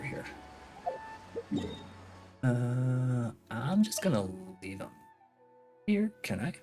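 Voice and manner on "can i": ordinary talk, nothing marked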